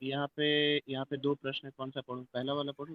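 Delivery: faint and distant over a phone; very soft, far from the mic; barely audible. Real, not acted. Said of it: "आपकी आवाज कम आ रही है".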